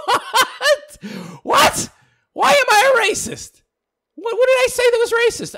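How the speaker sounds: very high, loud squawk of mock shock; hammed-up disbelief. Host answering a racism accusation, shrieking mock disbelief. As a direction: screech it in exaggerated bewilderment. Reacts to being called racist.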